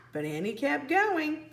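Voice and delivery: usual speaking voice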